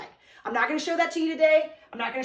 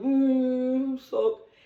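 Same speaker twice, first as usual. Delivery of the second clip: sulky; 3 dB louder than usual. Shown as a demonstration. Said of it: "Sulk means to be like".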